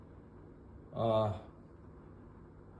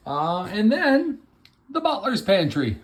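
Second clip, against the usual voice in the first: excited; 6 semitones higher, 3 dB louder than usual. Real, not acted. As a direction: present it with excited fanfare. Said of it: "Sorry, I got excited".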